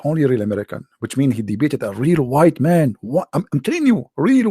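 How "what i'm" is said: usual speaking voice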